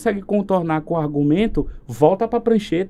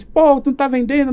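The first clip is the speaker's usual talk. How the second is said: a hint of an annoyed boss, a little higher and louder; mock scolding boss; 7 semitones higher, 4 dB louder than usual. Boss to the sales team, mildly mock scolding.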